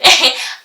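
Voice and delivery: short amused laugh. Real, not acted. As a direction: laugh at yourself. Laugh after the word.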